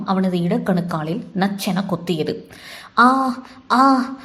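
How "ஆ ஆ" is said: two high, pained yelps; cry of pain. A narrator's reading. Shiva bitten by the cobra, crying out in pain. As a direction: yelp as if just bitten. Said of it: "அதற்கு மேல் அலற இயலாமல்".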